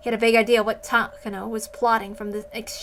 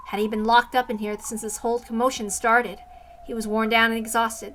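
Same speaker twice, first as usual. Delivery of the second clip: plain reading voice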